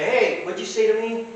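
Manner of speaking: high-pitched imagined challenge; mock confrontation. Pirate challenging a passer-by, mock confrontation.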